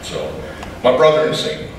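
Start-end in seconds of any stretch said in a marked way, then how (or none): none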